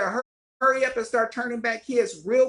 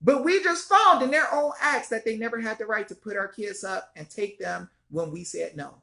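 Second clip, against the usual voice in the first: ordinary delivery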